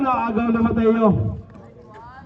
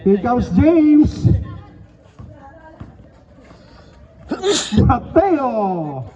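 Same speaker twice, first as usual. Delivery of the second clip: stretched-out names and score calls; live play-by-play; 4 semitones higher, slower than usual. Real, not acted. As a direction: call each basket like a courtside announcer.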